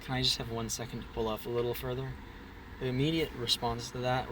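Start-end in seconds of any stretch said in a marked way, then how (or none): 0.0-2.1 soft, fast muttered repeat; quiet fast mutter. Retold or imitated. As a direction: mutter it to yourself, quickly. He didn't hear me.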